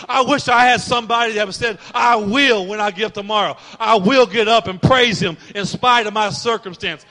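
loud, rising preacher's cry; preaching at full force. Acted. Preacher urging church to praise in spite of circumstances, loud. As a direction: belt it out to the congregation.

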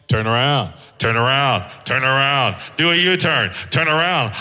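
GPS nagging turn around, repeated; mock GPS voice. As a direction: nag like a phone navigation voice. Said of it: "your G P S is yelling at you".

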